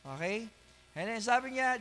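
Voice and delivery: ordinary delivery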